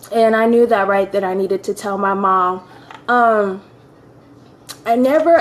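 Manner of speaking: normal talking voice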